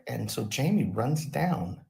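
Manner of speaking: usual speaking voice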